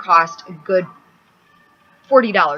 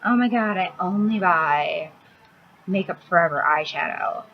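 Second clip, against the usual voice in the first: mocking makeup snob. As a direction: gush like a snobby makeup girl, pitched up. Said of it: "all those girls out there like".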